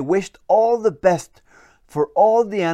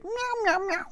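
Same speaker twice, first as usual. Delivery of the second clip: very high soft meowing; high, soft kitty meows; cat meows; 6 dB quieter than usual. Acted. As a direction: meow like a little cat. Cat speaks as cat.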